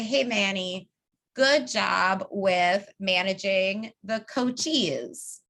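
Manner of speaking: slow and deliberate on "managing the coachees"; a measured, specific compliment. Shown as a demonstration. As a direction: take your time over the detail. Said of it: "I might say".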